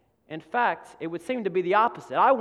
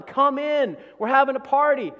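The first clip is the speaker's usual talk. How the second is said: pleading father; 8 semitones higher, 9 dB louder than usual. Acted. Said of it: "he tries to beg the son".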